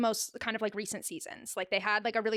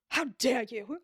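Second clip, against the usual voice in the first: mock-offended put-on voice; funny affronted voice; slower than usual. Retelling a slap sketch, mock affronted. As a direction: act lightly outraged after a gasp. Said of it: "in different funny voices".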